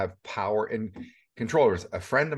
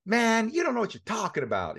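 put-on South African accent; 10 semitones higher than usual. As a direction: mimic his South African accent, pitched way up. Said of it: "He's got that, that South African accent".

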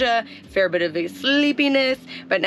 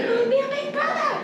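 much higher, fast and gushing; excited dog talk; faster than usual. Real, not acted. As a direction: squeal the news at the dog. Telling the dog the news.

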